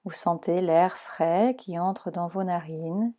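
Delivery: calm, even meditation voice guiding relaxation; guided meditation. Acted. Leads Yoga Nidra relaxation.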